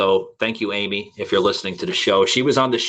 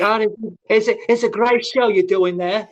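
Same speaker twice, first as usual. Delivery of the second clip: high-pitched attempt at an Australian accent; bad Australian accent; 11 semitones higher than usual. Retelling an Australian wrestler's compliment, bad Australian accent. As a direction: badly imitate an Australian woman, pitched up high. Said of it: "I can't do the Australian accent".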